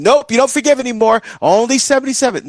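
high, quick, prissy cut-off; mock legalistic refusal. Preacher mocking a literal count, high and fast. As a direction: parody a rule-counter slamming the door.

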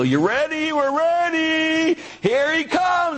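high, drawn-out showman's build-up; hammed-up TV healer working the crowd; Benny Hinn. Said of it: "it's kinda like Benny Hinn".

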